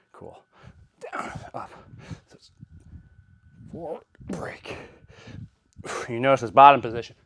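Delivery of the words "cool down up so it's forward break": breathy whispered cues mid-lift; whispering. Real, not acted. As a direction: whisper the cues under effort. Cueing himself mid-lift.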